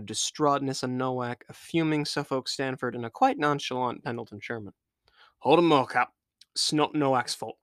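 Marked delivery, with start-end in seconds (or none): 5.4-7.6 a hint of a sailor defending his mate; lightly voiced deckhand, a touch insistent; gruff crewman. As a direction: sailor lightly pleading a shipmate's case.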